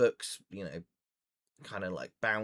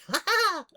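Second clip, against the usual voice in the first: high, loud gnome laugh; sudden high-pitched manic burst of laughter; excited eccentric cackle; 10 dB louder than usual. Acted. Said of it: "excited and a little bit eccentric".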